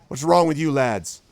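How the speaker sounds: a bully demanding answers; gruff challenge. Acted. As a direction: call out the cowards. Hack challenges the crowd.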